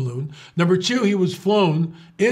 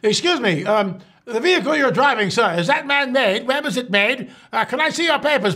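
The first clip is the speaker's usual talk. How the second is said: high, prim mocking inspector voice; sneering bureaucrat asking for papers, pitched up; mock officious official; 7 semitones higher than usual. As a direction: play a fussy border guard demanding papers. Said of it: "as I was poking fun at this".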